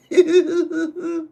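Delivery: very high, loud squealing laugh; helpless squeal of laughter; real high laughter. Real, not acted. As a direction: lose it completely. Cracks up while reading story.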